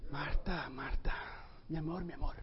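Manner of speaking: a husband softly calling his wife his love; tender. Shown as a demonstration. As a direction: murmur it lovingly to your wife. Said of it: "háblale así, con este tono".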